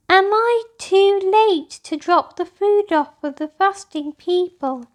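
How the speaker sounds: lightly voiced little girl's anxious question; little girl asking. A narrator's reading. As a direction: ask like a small girl afraid she's late. Child character asks anxiously.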